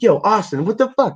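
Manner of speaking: high-pitched mock disbelief; retold incredulous protest. Retelling his protest to Austin over the fight deal, incredulous. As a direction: protest to a buddy, pitch climbing sharply.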